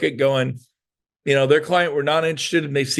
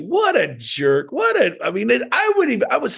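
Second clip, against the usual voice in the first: mock outrage; 9 semitones higher than usual. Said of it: "I'm like".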